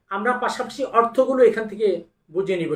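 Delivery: ordinary talk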